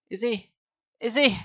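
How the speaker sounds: high, halting repeated question; stammering. A narrator's reading. Sari fears for her motionless father, stammering. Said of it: "Sari stammered".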